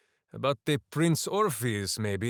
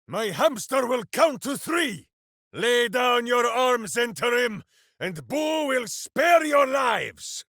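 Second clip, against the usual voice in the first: booming threat; 9 semitones higher than usual. Acted. Ranger warning enemies that Boo will strike. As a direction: threaten them with grand bluster.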